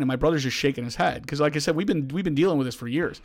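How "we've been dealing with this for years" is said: usual speaking voice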